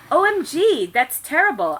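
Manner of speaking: high, loud hammed-up shock; overacted horrified gasp; mock gasp. A narrator's reading. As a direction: squeal the OMG. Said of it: "I gasped".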